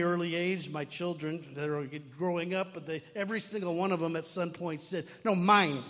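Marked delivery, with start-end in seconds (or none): 5.2-5.8 put-on louder, emphatic toddler protest; mock childish insistence; imitated possessive child. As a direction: blurt it like a toddler claiming a toy. Imitating his small children being possessive.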